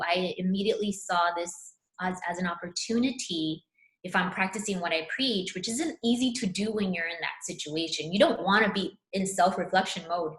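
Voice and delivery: ordinary talk, nothing marked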